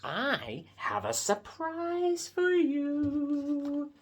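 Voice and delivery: high, slow, sing-out tease; excited announcement. Acted. Piggy announcing a surprise to Gerald, slow excited high voice. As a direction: draw it out high, savoring the news.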